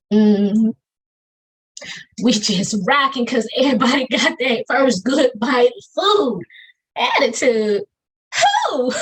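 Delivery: rhythmic, lively poetry performance with a preacher's lift; spoken word poem. Acted. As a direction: perform it like a slam poem to a cheering room.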